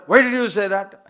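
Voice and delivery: loud mock demand; indignant challenge. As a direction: demand it loudly, playing the offended one. Acting out someone challenging another.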